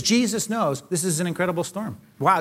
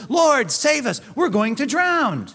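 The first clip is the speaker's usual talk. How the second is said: panicked plea; 7 semitones higher, 4 dB louder, slower than usual. Pretend. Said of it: "Disciples went and woke him up and said".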